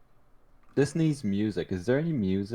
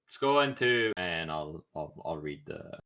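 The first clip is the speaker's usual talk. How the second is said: normal talking voice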